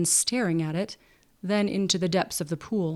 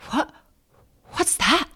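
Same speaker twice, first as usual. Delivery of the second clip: startled, much higher, stumbling; stammered; 8 semitones higher than usual. A narrator's reading. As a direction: caught off guard, blurting it out. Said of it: "stammered Freckles".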